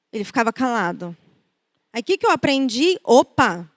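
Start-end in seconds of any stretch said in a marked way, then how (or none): none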